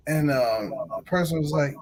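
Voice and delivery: normal talking voice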